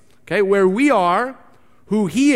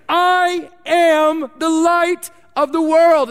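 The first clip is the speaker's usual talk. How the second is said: slow, loud, much higher proclamation; bold declaration; 8 semitones higher, 4 dB louder, slower than usual. Acted. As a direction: proclaim it like Jesus announcing himself on the street. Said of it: "Jesus begins the passage this way".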